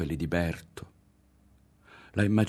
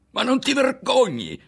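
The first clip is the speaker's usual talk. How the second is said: sudden loud, high yell; a loud, high yell of outrage; 7 dB louder than usual. A narrator's reading. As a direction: burst out yelling at him. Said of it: "urlò a un tratto".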